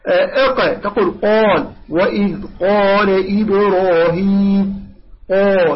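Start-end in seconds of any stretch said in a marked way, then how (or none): 1.2-5.8 slow recitation, dark rounded "qaw" in قال; measured model of heavy قال; correct heavy qaf. Shown as a demonstration. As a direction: stretch قال full and raised. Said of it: "لا تقول قال".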